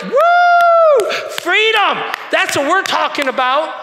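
high, loud victory whoop; crowd shouting for freedom. Retold or imitated. Preacher voicing the crowd cheering for freedom, shouting high and loud. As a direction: scream it like a rally. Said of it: "they're like".

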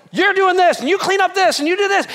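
high, loud rapid orders; barked chores. Acted. Father shouts chore orders at his family, loud and high. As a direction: hand out jobs like a drill sergeant.

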